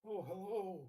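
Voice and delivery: put-on elderly man voice. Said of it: "you sound like an elderly man".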